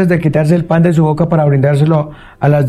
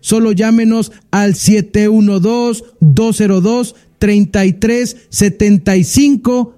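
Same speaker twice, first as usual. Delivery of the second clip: radio announcer; 6 semitones higher than usual. Acted. Host gives phone number.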